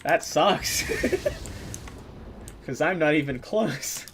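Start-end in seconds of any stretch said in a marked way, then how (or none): 0.9-2.6 unvoiced, very high wheezing laugh; a silent high wheeze of laughter; airy wheeze-laugh; breathless high wheeze. Real, not acted. Laughing at Brit comment.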